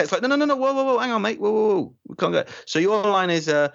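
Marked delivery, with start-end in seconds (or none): 0.2-2.4 higher, hurried "whoa, whoa" panic; mock-panicked Doctor stopping Ben leaving; flustered Doctor. Shown as a demonstration. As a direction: scramble to stop a mate walking off. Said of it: "so unlike the Doctor to say".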